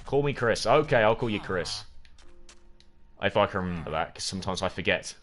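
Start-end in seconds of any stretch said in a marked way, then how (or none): none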